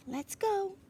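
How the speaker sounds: put-on high, eager boy greeting a friend; excited high kid voice. A narrator's reading. Charles happily greets the squirrel, high and excited. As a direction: pitch way up like a thrilled child.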